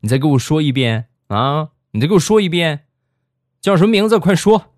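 high, loud mock interrogation, building; acted angry demand. Nurse demanding her boyfriend's name, mock furious.

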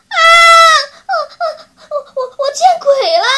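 high, loud, stuttering hysterical sobs; tearful wailing. A narrator's reading. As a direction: sob and stammer fast, rising to a wail. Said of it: "娇娇哭着说".

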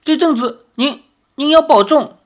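a daughter begging her father to take care; daughter's plea. A narrator's reading. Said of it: "林月如说". (女儿不舍地叮嘱)

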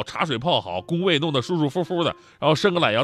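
usual speaking voice

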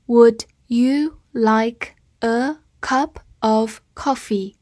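Would you like a cup of coffee, drawn out word by word; slow reading. Shown as a demonstration. Teacher reading slowly for students to repeat. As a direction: read it slowly and clearly. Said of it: "好，慢慢来读。".

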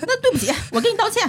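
high, laughing send-up of a careless sorry; mocking fake apology. To friends, mocking the manager's sorry, laughing. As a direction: mimic the grudging apology through giggles.

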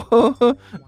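short embarrassed chuckle; awkward dry laugh. Acted. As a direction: laugh it off nervously. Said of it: "汪旭干笑着说道".

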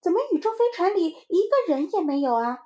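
put-on high, loud questioning kid; puzzled little child. (小孩高声疑问)

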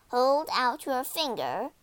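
plain reading voice

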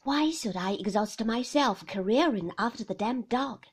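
irritated husband. A narrator's reading.

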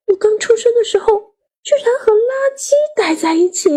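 high-pitched alarmed disbelief; panicked child. A narrator's reading. Child reacting to being told of the trash bin, panicked. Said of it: "我立刻慌了".

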